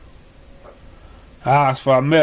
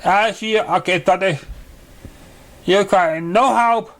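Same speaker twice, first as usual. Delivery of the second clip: higher, theatrical voice of the contest judge; grand judge character; 5 semitones higher than usual. Acted. Judge announcing contest rules to the three ponies, higher grand voice. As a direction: announce it like a pompous magical host.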